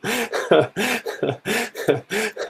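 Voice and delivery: high-pitched giggling laugh; real laugh. Real, not acted. Player delighted at rolling a six, high laugh. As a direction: burst into soft, high laughter.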